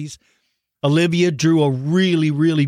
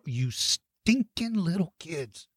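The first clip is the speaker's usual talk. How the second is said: mock grumble; 9 dB quieter, slower than usual.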